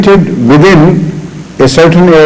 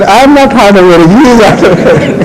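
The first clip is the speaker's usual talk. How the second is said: higher, laughing through the words; 6 semitones higher than usual. Real, not acted. Retelling the doctor's quip, laughing through it. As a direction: giggle the punchline out.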